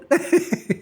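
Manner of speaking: short high-pitched giggle; quick giggle at a silly word; amused laugh. Real, not acted. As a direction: burst into a brief high laugh. Laughs at her funny word.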